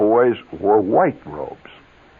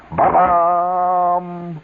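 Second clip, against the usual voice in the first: imitated piano chord; 9 semitones higher than usual. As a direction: belt out the chord the teacher bangs on the piano. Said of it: "She makes a big chord like that".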